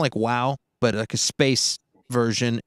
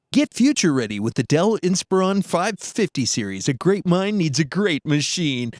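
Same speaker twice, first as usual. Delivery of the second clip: higher, polished announcer delivery; commercial ad read; 4 semitones higher than usual. Shown as a demonstration. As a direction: sell it like a tech commercial. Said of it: "I can do stuff like".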